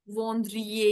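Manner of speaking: "वॉन्द्रिये" said with the extra ई sound as a clumsy mistake; awkward wrong form. Shown as a demonstration. Teacher shows students the bad pronunciation "वॉन्द्रिये". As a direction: demo the ugly "वॉन्द्रिये" the wrong way. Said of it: "ये ऐसे हो जाएगा तो अच्छा नहीं लगेगा".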